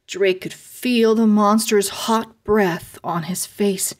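usual speaking voice

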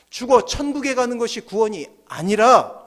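normal talking voice

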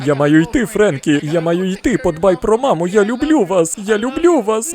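hammed-up desperate goodbye, climbing in pitch; mock panicked farewell. Dad fakes last goodbye to Frankie, rising mock panic.

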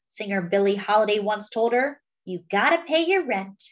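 ordinary delivery, nothing marked

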